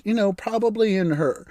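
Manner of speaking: ordinary delivery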